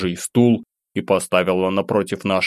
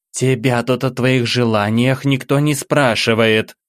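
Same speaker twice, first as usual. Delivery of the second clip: slow, higher, irritated scolding; getting angry; 4 semitones higher than usual. Acted. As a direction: let anger break through the words. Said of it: "стала откровенно злиться куратор". (нарастающая злость)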